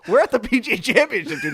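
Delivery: high, loud incredulous jab; mock exasperation. Teasing a friend hiding from the sun, loud incredulous. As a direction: needle your friend in disbelief.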